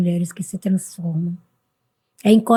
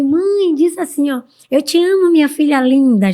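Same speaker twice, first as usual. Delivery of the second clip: higher, lilting, joking plea; playful coaxing; 6 semitones higher, 4 dB louder than usual. Retold or imitated. Daughter coaxing mum to say "I love you", playfully. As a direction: coax mum lightly, as a joke. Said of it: "Já fui levando pra o, pra o tom de brincadeira".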